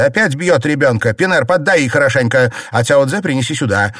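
loud, high, indignant orders; angry cry. A narrator's reading. Mistress orders the maid to punish the nanny, crying out angrily. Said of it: "вскричала Фэнзе".

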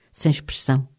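ordinary delivery, nothing marked

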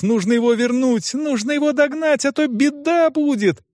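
high-pitched anxious pleading; desperate girl pressing her case; urgent girl. A narrator's reading. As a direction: warn of disaster in a high, insistent voice. Girl warns of coming disaster.

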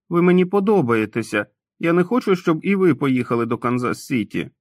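put-on pleading childlike Tom. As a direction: beg him not to leave. (щире дитяче благання, вище)

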